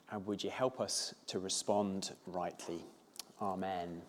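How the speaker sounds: soft, hushed opening prayer. Real, not acted. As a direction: pray quietly and reverently to God. Opens with a prayer.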